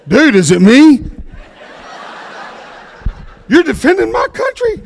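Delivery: loud, high mock disbelief; incredulous disbelief. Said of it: "He said".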